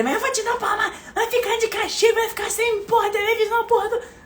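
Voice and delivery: very high shrill mock threats of a yelling mother. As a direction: parody a mother shouting punishments. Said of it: "Em vez de". (imitando mãe berrando ameaças, voz bem aguda)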